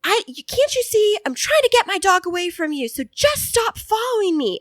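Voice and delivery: high-pitched imitation of a woman yelling; mock angry yell. Woman with muzzled dog yelling at passing couple, high mock yell. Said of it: "yelling at them".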